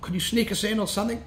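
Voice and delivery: imitated polite student. Acting the guy pleading for entry.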